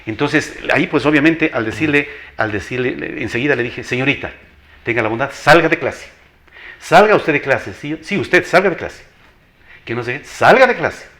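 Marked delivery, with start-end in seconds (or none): 3.8-11.1 louder, firm "salga de clase" command; stern order out. Retold or imitated. Teacher losing patience, ordering the girl out sternly. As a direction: throw the student out with a firm voice. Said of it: "con un tono de una voz".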